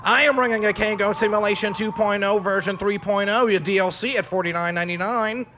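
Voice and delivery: high, loud alien announcer. Acted. Alien voice continues.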